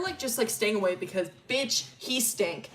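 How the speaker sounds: ordinary talk, nothing marked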